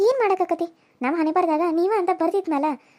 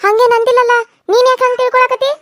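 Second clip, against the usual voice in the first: angry; 4 semitones higher, 5 dB louder than usual. Acted. Mother-in-law quarrel.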